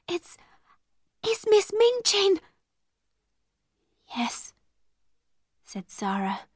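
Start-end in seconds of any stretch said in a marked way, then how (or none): 0.1-2.4 high, stammering alarm; falter, then squeak out the name; frightened whisper. A narrator's reading. Becky warning that Miss Minchin is coming, frightened. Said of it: "whispered Becky". 4.1-4.5 hushed dread. A narrator's reading. Sara answers as footsteps approach.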